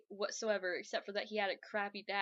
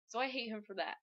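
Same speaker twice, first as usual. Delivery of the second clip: ordinary talk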